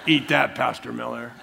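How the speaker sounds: chuckling jab at a friend; laughing tease. Real, not acted. Preacher ribs a fellow pastor while laughing. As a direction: tease him through a laugh.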